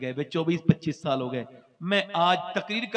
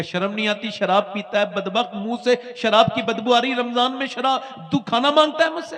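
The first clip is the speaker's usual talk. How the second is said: mother's scolding builds to a fast, higher, louder rebuke; rising fury at her drunken son, faster at the end; angry mother scolding; 4 semitones higher, 6 dB louder than usual. Acted. Mother berates her drunken son during Ramzan, building angry scolding.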